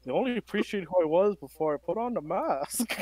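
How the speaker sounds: menacing masked villain; Bane voice. Acted. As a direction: growl it behind a mask. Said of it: "All right, Bane".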